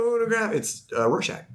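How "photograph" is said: high-pitched mock rock-singer voice; [name] singer. Podcaster answering who sings for [name], singer impression. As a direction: belt it out like a parodied rock frontman. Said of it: "the singer for [name]".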